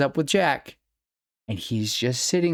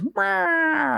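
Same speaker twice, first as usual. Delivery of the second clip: mock meow. Baby doll toy cries.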